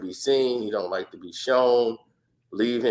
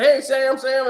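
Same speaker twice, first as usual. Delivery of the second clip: calling out; 12 semitones higher, 5 dB louder than usual. Retold or imitated. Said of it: "Everybody be like".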